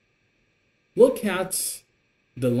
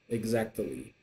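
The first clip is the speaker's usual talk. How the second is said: usual speaking voice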